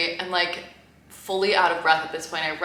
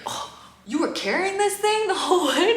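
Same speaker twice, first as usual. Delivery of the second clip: lightly voiced incredulous New York guy, higher; New York delivery guy; 13 semitones higher, 4 dB louder than usual. Delivery driver reacting to her heavy box, high incredulous voice. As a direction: sound amazed she hauled it all that way. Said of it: "a really thick New York accent".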